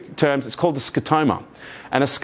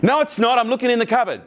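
mock insistence; 7 semitones higher than usual. Acted salt quarrel with partner.

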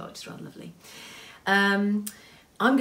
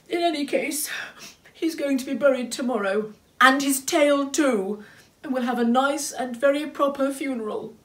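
high, raised, determined voice; insistent, upset character; 9 semitones higher, 7 dB louder than usual. A narrator's reading. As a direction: insist firmly through the grief.

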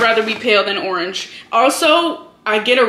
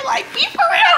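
exasperated squeal. Real, not acted. Exasperated aside at shirt stain.

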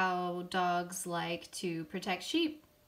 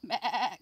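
a short louder "bah" like a worried lamb; mock sheep bleat; 6 dB louder than usual. A narrator's reading. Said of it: "cried the lamb".

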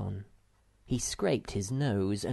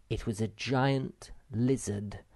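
plain reading voice

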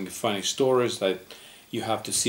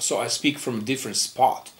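ordinary talk

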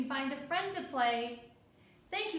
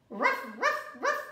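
louder yappy ruff ruff; puppy bark; 3 semitones higher, 5 dB louder than usual. Acted. Puppy answering the child, barking. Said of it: "barked the puppy".

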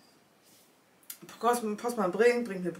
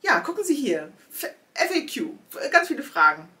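higher, chirpy FAQ voice; mock website voice; 7 semitones higher, 4 dB louder than usual. The site pointing to its FAQ, chirpy mock voice. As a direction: chirp it like a help page.

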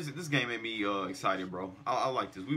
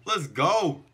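cheer; 4 semitones higher, 5 dB louder, much slower than usual. Real, not acted. Fan cheering at game.

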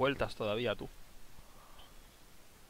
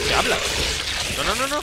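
alarmed; 9 semitones higher, 4 dB louder than usual. Real, not acted. Game going wrong mid-play.

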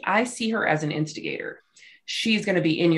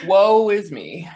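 mock woe; 6 dB louder, much slower than usual. Mocking the suspect's self-pity.

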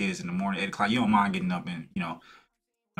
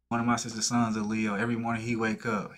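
usual speaking voice

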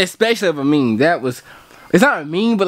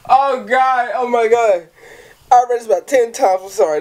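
ordinary talk

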